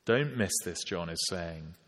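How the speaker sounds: ordinary talk, nothing marked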